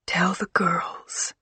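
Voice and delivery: weak, slow whisper. Acted. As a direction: whisper it faintly from bed. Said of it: "she whispered".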